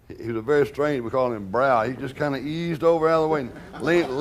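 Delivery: usual speaking voice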